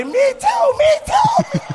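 very high, squealed imitation; mock lover's cry. Man voices what his lover better say, high mock squeal. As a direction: squeal it like a hammed-up woman in bed. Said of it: "You better say".

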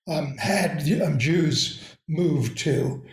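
hoarse voice giving out; raspy, failing voice mid-lecture; losing voice. Real, not acted. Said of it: "I'm losing my voice".

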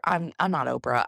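ordinary talk, nothing marked

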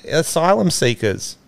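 ordinary delivery

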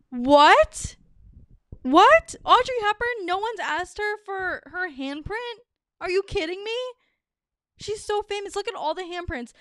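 high, disbelieving "What?"; shocked disbelief. Real, not acted. Host telling listeners the Audrey Hepburn story, in high disbelief. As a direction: gasp it out, pitch shooting up.

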